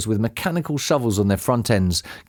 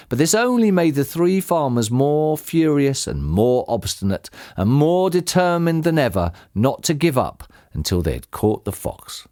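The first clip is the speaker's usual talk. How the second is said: usual speaking voice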